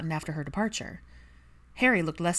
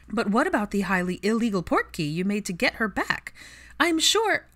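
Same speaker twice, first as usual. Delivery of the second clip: higher, louder scolding rant; Pansy voice, incredulous; 7 semitones higher, 4 dB louder than usual. A narrator's reading. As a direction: tear into him, half amused, half appalled. Pansy lists offences.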